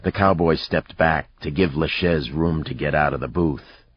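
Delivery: ordinary delivery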